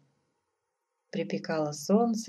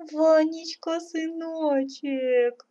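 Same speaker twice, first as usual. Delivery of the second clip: high, drawn-out acted crying; tearful; 11 semitones higher, 4 dB louder, much slower than usual. A narrator's reading. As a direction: wail tearfully to your son, stretching the words. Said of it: "заплакала царица". (плаксиво, высоко и протяжно)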